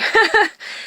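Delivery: short, high laugh fighting back tears; tearful laugh. Real, not acted. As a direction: laugh the emotion away, embarrassed. Laughs off emotion over uncle.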